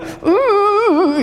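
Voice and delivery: put-on high whining kid noise. Son whining while losing.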